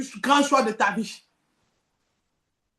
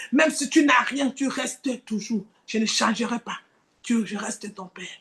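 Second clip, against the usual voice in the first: voice of God; 2 semitones lower than usual. Acted. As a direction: speak as a tender father promising never to leave. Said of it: "La voix de la guérison".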